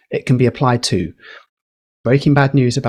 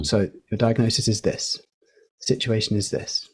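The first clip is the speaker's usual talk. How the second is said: "diagnosis" and "situation" said softer, falling firmly to a full stop; 2 semitones lower, 8 dB quieter than usual. Shown as a demonstration. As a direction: drop the tone at the end of each phrase to make the point. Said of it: "you go down if you wanna make a point".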